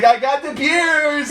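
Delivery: bright eager announcement; excited shout. Acted. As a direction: call it out proudly, slowly. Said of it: "She's like".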